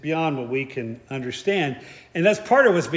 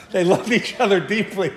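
laughing; 4 semitones higher, 3 dB louder than usual. Real, not acted. Laughing at own joke.

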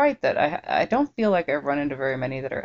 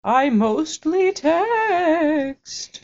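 high, slow wailing ghost; 10 semitones higher, much slower than usual. Acted. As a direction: moan it like a ghost. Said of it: "ghost voice".

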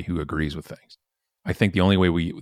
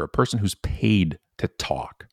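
ordinary talk

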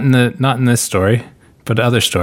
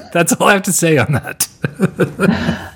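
laughing; 7 semitones higher, slower than usual. Real, not acted. Laughing at their own awkward situation.

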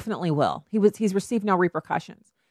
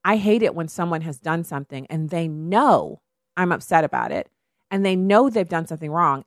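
ordinary delivery

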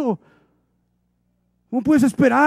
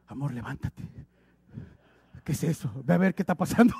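much softer and lower, begging the wife to go look; mock timid husband; 10 semitones lower, 10 dB quieter than usual. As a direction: hide behind your wife, barely voicing it. Husband scared by night noise. (esposo asustado en la madrugada, en voz muy baja)

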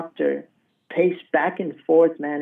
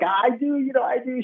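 higher-pitched imitation of an excited mom; fired-up mom; 8 semitones higher, 3 dB quieter than usual. Mom answering the doctor about miracles, fired up. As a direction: lightly mimic mom getting worked up, pitched well up. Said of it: "She's getting all fired up".

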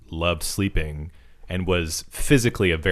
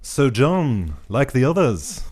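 put-on higher-pitched makeshift British accent; attempted British accent; 4 semitones higher, slower than usual. Podcast host reads a paragraph as a Beatles producer, attempted British accent. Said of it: "Just do a British accent".

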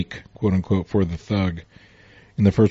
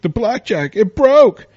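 much higher and louder, a mock panicked cry; shocked thug; 13 semitones higher, 8 dB louder, slower than usual. The thug seeing his blackjack break on Superman, mock shocked cry. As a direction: yelp it like a stunned crook.

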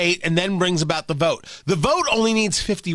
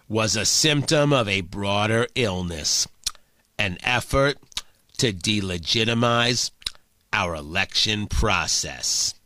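very low, slow, deliberate Schumer voice; slow, deep senatorial drone; Schumer impression; 11 semitones lower, slower than usual. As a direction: drop way down and drag each phrase like Schumer. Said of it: "I can do the impression".

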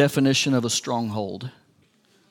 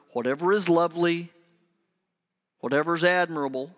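normal talking voice

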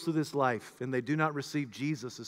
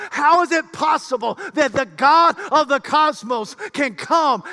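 high, loud, driving preacher's proclamation; full-force preaching; 10 semitones higher, 11 dB louder than usual. Real, not acted. Preacher proclaiming the great mystery to the congregation, full-force preaching. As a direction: preach it out at the top of your voice.

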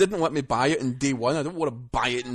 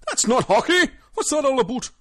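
mock outraged Canadian; 12 semitones higher, 6 dB louder than usual. Mocking Canadian listeners' outrage.

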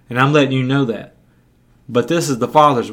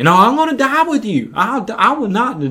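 high-pitched insistent denial; earnest protest; 8 semitones higher than usual. Acted. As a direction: act out a disciple swearing loyalty. Peter protests he will never deny.

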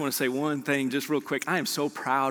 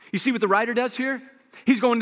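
normal talking voice

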